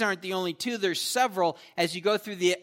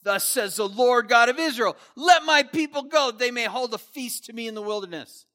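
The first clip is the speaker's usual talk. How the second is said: louder and higher, a flat demand; blunt command; 5 semitones higher, 5 dB louder than usual. Retold or imitated. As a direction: declare it like a royal order. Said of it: "Much different tone".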